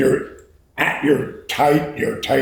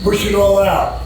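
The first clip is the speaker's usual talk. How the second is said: coaching shout; 5 semitones higher, slower than usual. Real, not acted. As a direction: drive him through the sprint, shouting. Coach pushing rider through sprint.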